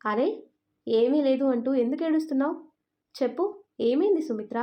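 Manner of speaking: usual speaking voice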